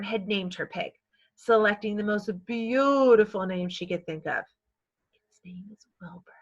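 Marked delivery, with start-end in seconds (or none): 5.1-6.3 soft unvoiced secret whisper; whisper to herself. Girl Fern naming her pig to herself, whispering. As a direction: breathe the name like a secret. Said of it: "she whispered to herself".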